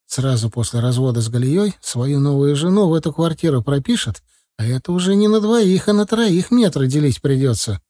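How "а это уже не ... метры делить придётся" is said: plain reading voice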